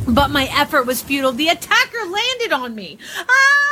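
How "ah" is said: sudden high, loud scream of terror; startled narrator's shriek. A narrator's reading. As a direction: shriek as if grabbed in the dark. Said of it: "I screamed in vain".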